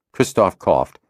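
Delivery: plain reading voice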